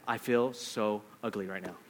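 low, soft, defeated murmur; deflated mutter. Pretend. In front of someone attractive, a quiet deflated murmur. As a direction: sink into quiet embarrassment. Imagined self-talk beside true beauty.

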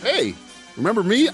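normal talking voice

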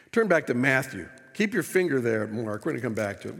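normal talking voice

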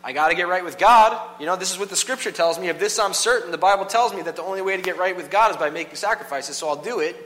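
loud, exasperated resignation; grudging worshipper. Retold or imitated. A worshipper gives in to the priest, exasperated and loud. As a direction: give in loudly, annoyed but certain. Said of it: "you'd be like".

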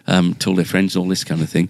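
ordinary talk, nothing marked

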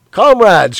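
loud, high announcer cry; eager cry. Acted. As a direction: call out to the nation eagerly. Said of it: "cried an eager, youthful voice".

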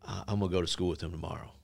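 low, soft, quick imitation of dad; quietly final. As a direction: settle the matter without raising your voice. Dad cuts mom off, retold.

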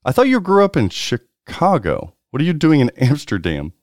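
normal talking voice

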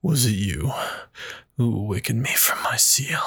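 whispered menacing demon voice; scary deadly demon. Acted. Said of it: "give us scary, scary deadly".

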